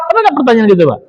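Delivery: ordinary delivery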